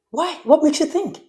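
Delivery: ordinary talk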